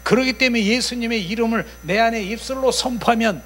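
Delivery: loud, high-pitched sermon delivery; full-force preaching. Real, not acted. Preacher declaring the power of Jesus' name, full-force preaching. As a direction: drive it home to the congregation.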